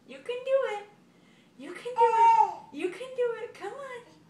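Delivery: loud high cheering; excited cheering. Real, not acted. Cheering toddler to walk.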